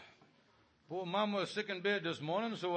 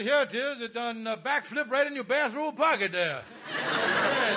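ordinary talk

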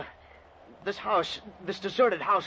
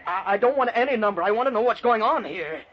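raised, angry protest; indignant; 4 semitones higher than usual. Acted. Man refusing cheek from the operator, indignant. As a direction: snap back indignantly, pitched up.

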